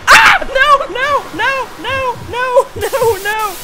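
high shriek into repeated wailing; real terror, squealing each no; panicked scream. Real, not acted. Frightened by monster in game.

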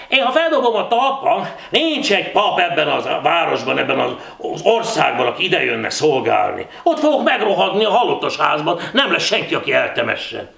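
loud, high-pitched lament; indignant rant. Acted. Csikar to his pub friends about his burial, indignant rant. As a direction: rail about your fate.

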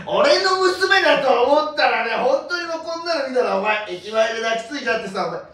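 loud, high, laughing plea to a stranger. Retold or imitated. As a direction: yell it like a worried father. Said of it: "マジでこの声量やったからな".

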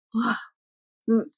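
usual speaking voice

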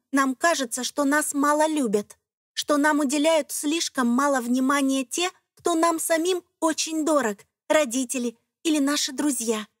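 ordinary delivery, nothing marked

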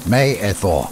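usual speaking voice

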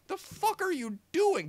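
real exasperated yell; exasperated outburst. Real, not acted. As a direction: shout at the screen in disbelief. Outraged at the movie.

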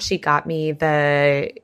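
ordinary talk, nothing marked

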